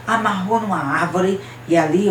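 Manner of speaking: plain reading voice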